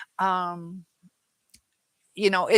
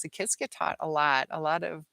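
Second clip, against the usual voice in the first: usual speaking voice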